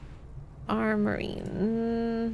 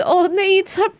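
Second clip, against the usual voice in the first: startled; 8 semitones higher, 8 dB louder than usual. Real, not acted. Startled by something outside.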